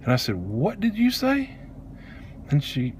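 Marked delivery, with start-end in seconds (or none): 0.3-1.5 high, slow, soft incredulous question; shocked disbelief. Retold or imitated. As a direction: ask it stunned, pitch climbing. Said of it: "Instead, I'm shocked".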